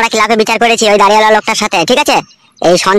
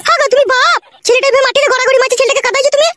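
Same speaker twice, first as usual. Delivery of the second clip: much higher, shrill-pitched wife voice; scolding mother. Acted. Wife scolding husband over crying son, high-pitched.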